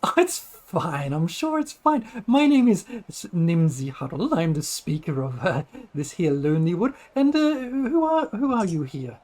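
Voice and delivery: high, swooping hostess voice; flustered, friendly little village speaker; halfling lady, reassuring. Acted. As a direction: brush off worries, then introduce yourself.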